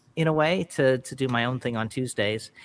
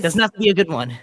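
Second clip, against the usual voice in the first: said through a laugh, higher and louder; 6 semitones higher, 7 dB louder, faster than usual. Real, not acted. Singer jokes to co-writer about their song, laughing. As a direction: toss it off, amused. Joking while breaking into laughter.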